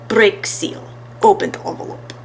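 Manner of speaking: smooth, foreign-sounding showman; suave professor. A narrator's reading. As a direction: purr it like a stage magician.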